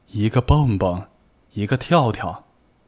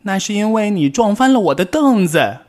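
put-on high, louder childish yell; 11 semitones higher, 6 dB louder than usual. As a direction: shout back in the quarrel. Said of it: "蹦蹦吼道".

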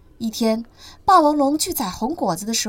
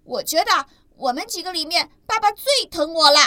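put-on higher, louder boasting child voice; a baby dinosaur showing off; smug baby dinosaur; 5 semitones higher, 5 dB louder than usual. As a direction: brag that you're dad's favourite. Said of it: "尼得意地说".

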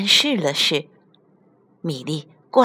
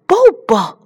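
high, loud questioning yelp; surprised cry; 6 semitones higher, 6 dB louder than usual. Acted. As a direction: cry out at a sudden discovery. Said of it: "小憨叫起来". (惊喜地高声叫起来)

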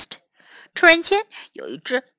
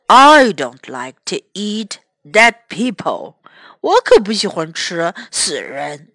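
bear voice; 4 semitones lower than usual. A narrator's reading. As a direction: rumble it out like a bear sniffing its prey. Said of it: "said the bear".

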